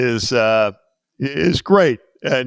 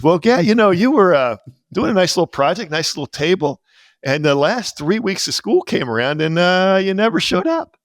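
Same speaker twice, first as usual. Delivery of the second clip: higher, story-telling teacher voice; imitating the shop teacher; 6 semitones higher than usual. Teacher recalling the missing student, higher. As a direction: recount it raised like the old teacher. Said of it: "He goes".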